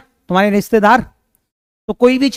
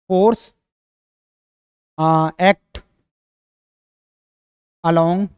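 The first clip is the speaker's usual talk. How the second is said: dictation; 5 semitones lower, 4 dB quieter, much slower than usual. Shown as a demonstration. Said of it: "एक और point लिख लो बढ़िया सा".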